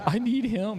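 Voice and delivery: desperate eagerness. Retold or imitated. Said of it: "I was like".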